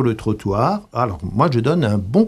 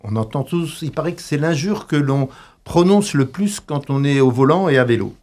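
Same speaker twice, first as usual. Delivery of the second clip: ordinary delivery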